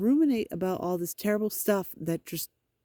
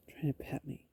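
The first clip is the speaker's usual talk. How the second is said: 1 whisper; 4 semitones lower, 11 dB quieter than usual. Real, not acted. Cat trying to pet her.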